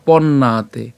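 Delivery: "পন্নাতে" slowly as pon-naa-te; careful Sanskrit pronunciation. Shown as a demonstration. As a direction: hold the long aa in "পন্নাতে". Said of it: "উচ্চারণটা কেমন হচ্ছে". ("পন্নাতে" ধীরে, আ-কার টেনে)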